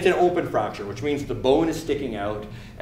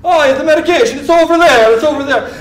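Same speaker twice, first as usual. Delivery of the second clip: hammed-up, very loud high yell; oblivious patient hollering directions in a put-on high voice; frantic patient; 11 dB louder than usual. Patient telling the medic where medication is, hammed-up frantic. Patient flailing his broken arm.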